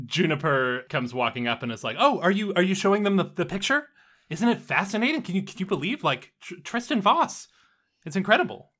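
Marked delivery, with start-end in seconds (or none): 2.0-8.7 put-on eager gushing girl. GM voices Juniper walking up.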